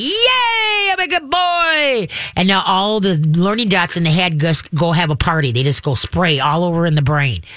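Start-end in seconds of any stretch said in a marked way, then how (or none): 0.0-2.1 squealing, very high happy-dog voice; overexcited dog. Acted. Dog goes nuts after being praised, overexcited. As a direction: squeal it high and drawn out in mock joy. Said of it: "the dog is gonna be like".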